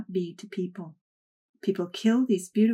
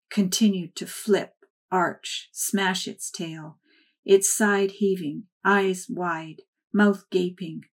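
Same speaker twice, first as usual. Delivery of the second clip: ordinary delivery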